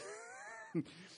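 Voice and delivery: squeaky amused laugh; high laugh. Real, not acted. Preacher laughing at the crowd's reaction, high and amused. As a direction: laugh at the absurdity.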